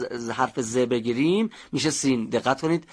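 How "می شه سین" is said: normal talking voice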